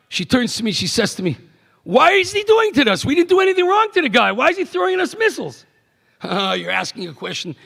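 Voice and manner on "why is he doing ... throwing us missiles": put-on high-pitched, quick child's protest; protesting daughter. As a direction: imitate an upset daughter asking why. Daughter's protest during Gulf War.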